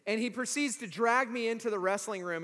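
usual speaking voice

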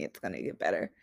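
mocking what people tell her, low and scornful; mocking quote. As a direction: parrot the empty platitude with contempt. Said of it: "I hate when people say that shit to me".

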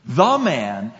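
"The man" with a weighty, set-apart "The"; stress on THE. Shown as a demonstration. Preacher contrasting any man with a specific man, stress on "The". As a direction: lean on "The" to single out one man. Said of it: "A man could be any man".

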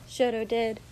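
usual speaking voice

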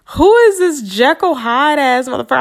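high, loud disbelieving exclamation; can't believe who he turned into; incredulous outrage. Retold or imitated. Shocked by the ex's sudden cruelty, incredulous.

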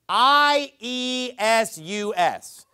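slow letter-by-letter spelling I E S U S; spelled out letter by letter. Shown as a demonstration. Preacher spelling the King James name slowly for the congregation. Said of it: "It's spelled like this".

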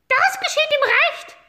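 very high, loud hen squawk; cackling hens. Acted. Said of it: "mit ihrem ewigen".

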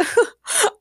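high bright burst of laughter. A narrator's reading. Sierra amused by the producer's worry, laughing. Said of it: "Sierra laughed".

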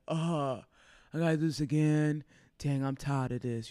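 low, tired mock grumble; acted-out weariness in a deep voice; deep weary voice. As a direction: sigh it out low, as if fed up. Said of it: "that's a really deep voice".

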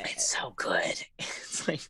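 low, whispered agreement. Real, not acted. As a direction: whisper back, sharing the secret. Whispered reply to whispered aside.

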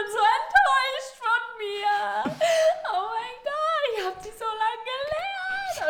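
high, slow mock sobbing lament; mock wailing. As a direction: wail it out like a hammed-up crying fit. Sie weint auf der Bühne nach. (hoch und langgezogen, gespieltes Heulen)

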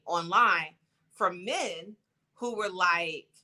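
usual speaking voice

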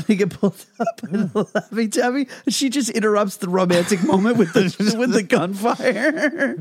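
laughing. Real, not acted. Laughing while retelling.

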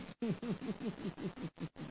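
quiet gleeful laugh to himself; soft delighted chuckle. Acted. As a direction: chuckle softly, pleased with his treasure. Alone, admiring his new bird.

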